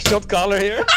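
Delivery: usual speaking voice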